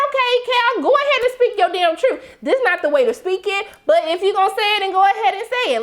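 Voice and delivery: high-pitched, animated egging-on; mock encouragement. Viewer egging on the drunk dad, mock encouragement. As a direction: pitch it way up and wave him on. Viewer cheering him on, retold.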